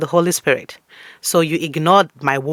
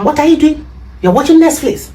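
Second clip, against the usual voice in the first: high, loud nagging mother; scolding mom; 10 semitones higher, 7 dB louder than usual. Acted. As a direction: catch the kid slacking and scold. Mom catching child on Netflix.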